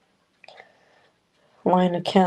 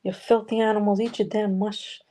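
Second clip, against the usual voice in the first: slow, higher scolding voice mocking a cruel caretaker; mock daycare worker snarling at kids to eat; mean daycare worker; 4 semitones higher than usual. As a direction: drag out the insult like a nasty babysitter. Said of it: "she'd be like".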